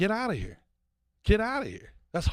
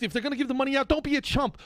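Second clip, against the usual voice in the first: usual speaking voice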